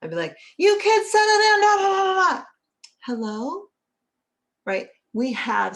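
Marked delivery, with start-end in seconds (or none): 0.6-2.4 very high, loud exasperated yell; yelling at kids. Retold or imitated. Mother yelling at running kids before the phone, exasperated shout. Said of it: "I'd yell". 3.1-3.6 sweet phone greeting. Retold or imitated. Answering phone after yelling.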